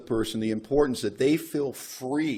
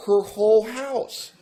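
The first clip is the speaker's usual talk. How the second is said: much higher and louder, dragged-out whole house; mock outrage; 10 semitones higher, 6 dB louder, slower than usual. Complaining to his sponsor about painting, high and indignant. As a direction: whine it in disbelief.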